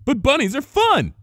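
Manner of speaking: high, loud delighted squeal. A narrator's reading. Pinkie squealing as she bounces in. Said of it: "squealed Pinkie Pie".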